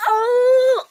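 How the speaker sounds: high, loud delighted squeal and giggle; a girl squealing with delight; excited princess shrieking with laughter. Acted. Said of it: "she stay all like".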